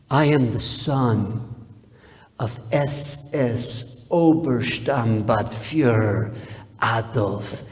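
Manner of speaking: very slow and deliberate, each title word weighted; proud son intoning his father's full rank; slow pompous announcement. Retold or imitated. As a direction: spell out the rank like a proclamation.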